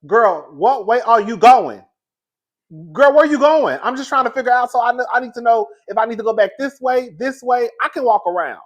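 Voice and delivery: loud, high retold demand; exasperated panic. Scared neighbour asking dog owner which way, loud exasperated panic. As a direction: raise the voice, desperate to get clear.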